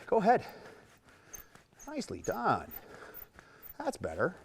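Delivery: soft encouraging voice to the mare; friendly encouragement. Shown as a demonstration. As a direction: coax kindly, letting it go. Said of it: "We're gonna say".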